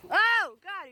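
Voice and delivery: sudden loud, high cry of alarm; real fright as a bird dives at you; startled yelp. Real, not acted. Bird attacking him.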